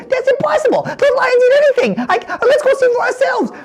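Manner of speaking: high-pitched scoffing wise man; squeaky wise man. Acted. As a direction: squeak out your disbelief.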